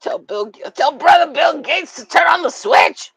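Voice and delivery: loud, high ranting yell; mock shout. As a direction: bellow the order in mock fury.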